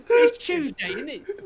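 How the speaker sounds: high, loud mock British accent; exaggerated British accent. Friends joking about bad British accents, high mock British accent. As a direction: squeal it like a cartoon British bloke. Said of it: "exaggerated British shit".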